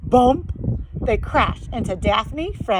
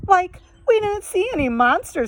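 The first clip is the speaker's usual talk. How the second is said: Shaggy voice; 9 semitones higher than usual. Acted.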